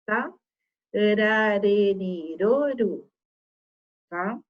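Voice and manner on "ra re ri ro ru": "Ra, re, ri, ro, ru" encadeado, R de um toque; R fraco. Shown as a demonstration. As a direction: emende "Ra, re, ri, ro, ru" com a língua batendo leve. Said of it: "de R fraco". ("Ra, re, ri, ro, ru" com R fraquinho)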